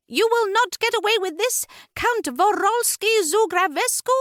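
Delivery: very high, resolute heroine; staunch warning. A narrator's reading. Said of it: "Ruby warned staunchly".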